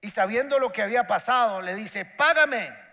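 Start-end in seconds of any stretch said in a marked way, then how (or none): 2.0-2.8 loud, higher, one sharp word; a servant collars his debtor; harsh demand. Acted. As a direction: demand the money like a creditor. Servant demands payment at the door.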